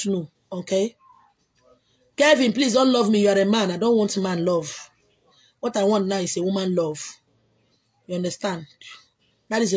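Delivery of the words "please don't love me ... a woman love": usual speaking voice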